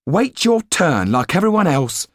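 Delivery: high, loud telling-off; scolding proprietor's wife. A narrator's reading. Proprietor's wife about to rebuke Yan at counter, scolding. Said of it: "She was about to say".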